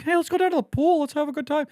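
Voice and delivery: high-pitched put-on enthusiasm; mock eager suggestion. Mocking anyone who suggests a pool outing, put-on eagerness. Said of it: "have I ever been like".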